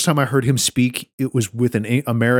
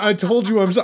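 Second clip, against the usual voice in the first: normal talking voice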